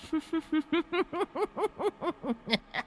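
high, soft ghostly cackle; eerie high demon laugh; evil cackle. A narrator's reading. Female demon laughs cruelly.

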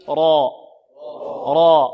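loud, heavy rolled را for repeating; full, thickened R on an open vowel in را; تفخيم الراء. Shown as a demonstration. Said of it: "هكذا انطقوا معي". (را مفخمة بصوت عالٍ)

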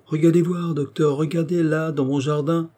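high, eager calls to look; excited urging. A narrator's reading. Old patient showing the doctor his garden, excited. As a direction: urge them to look, pitch held high.

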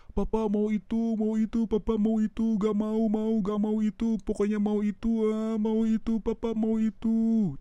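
put-on high, slow whining kid begging papa; nagging toddler voice, high and drawn out; whiny child. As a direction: beg like a spoiled little kid whining for a toy.

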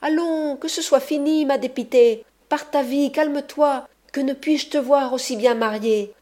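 a lightly voiced bully wheedling his lover; coaxing ruffian. A narrator's reading. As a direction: coax her out with mock sweetness. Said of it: "il disait du dehors".

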